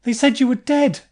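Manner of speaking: high, loud shocked cry; near-hysterical shock; distraught outburst. Acted. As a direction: blurt it out in disbelief. Distraught on seeing him alive.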